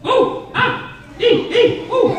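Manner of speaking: squealing high yelps in the shower; hammed-up shower squeals; high squeals from hot and cold water. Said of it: "like that, right?".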